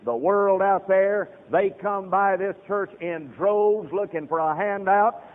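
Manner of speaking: loud, high hellfire sermon voice; fiery preaching. Acted. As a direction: thunder it at the congregation. Preaching at full force.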